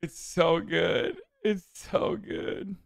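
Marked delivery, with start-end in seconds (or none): 1.4-2.8 whispered and slow, almost no voice; airy slow whisper of amazement; breathless hush. Real, not acted. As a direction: sigh it out in delight. Savoring the clip, said twice.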